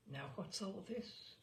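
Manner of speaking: very soft, slow murmur; almost inaudible. A narrator's reading. Colonel questions the narrator, almost inaudibly soft. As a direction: barely breathe the words. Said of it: "he asked me almost inaudibly".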